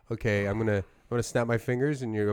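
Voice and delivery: normal talking voice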